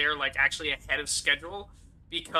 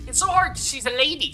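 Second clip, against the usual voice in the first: ordinary delivery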